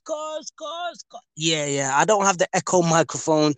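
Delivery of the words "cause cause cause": echoing the word cause, fading out. Acted. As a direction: make your own echo effect, fading. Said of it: "I'm trying to do the echo myself".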